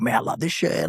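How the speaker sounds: high, hushed delight; mock thrilled aside; excited. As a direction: gush quietly like a fan. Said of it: "I'm like".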